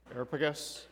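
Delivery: Areopagus rattled off quickly and softly; said fast. Shown as a demonstration. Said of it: "If I say it really fast".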